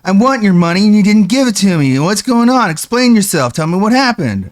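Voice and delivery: high, loud imitation of a begging streamer; mock streamer rant. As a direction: ham up a greedy streamer's demand for money. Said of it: "He's like".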